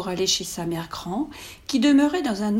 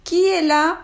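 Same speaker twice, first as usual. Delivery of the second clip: put-on higher, louder old woman calling; a frail granny calling through the door; old grandmother voice; 8 semitones higher, 7 dB louder than usual. Grandmother from her bed.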